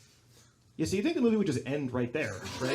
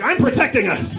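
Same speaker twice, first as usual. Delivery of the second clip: loud, high self-justifying yell; mock frantic defense; 8 semitones higher, 12 dB louder than usual. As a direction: shout back at your girlfriend in earnest defense. Frantic retold answer to girlfriend.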